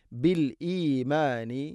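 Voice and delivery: ബിൽ ഈമാനി slow with the hamza before ഈ heard; hamza sounded. Shown as a demonstration. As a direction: break cleanly before ഈമാനി. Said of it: "ഹംസ ഉച്ചരിക്കണം". (ഹംസ വ്യക്തമാക്കി ബിൽ ഈമാനി)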